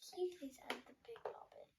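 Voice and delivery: whispering. Real, not acted. Asking a favour quietly.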